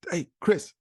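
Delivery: high-pitched spunky call-out; John. Retold or imitated. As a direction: imitate a peppy, high-voiced mentor. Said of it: "that's the way he talks".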